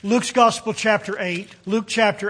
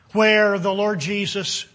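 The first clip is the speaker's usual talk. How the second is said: ordinary talk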